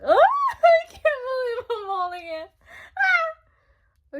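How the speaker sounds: overjoyed disbelief; excited squeal. Real, not acted. Fan finally holding the album, shrieking with joy.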